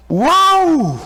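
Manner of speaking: long, high swooping "wow"; amazed wow. Retold or imitated. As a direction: imitate an astonished crowd with one big rising-falling whoop. Imitating Americans amazed at overdressed guest.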